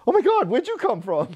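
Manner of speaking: imitating a startled mother, pitched high; mock astonishment. Mother turning round to the kids, imitated high-pitched surprise. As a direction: act out an amazed double take, voice shooting up.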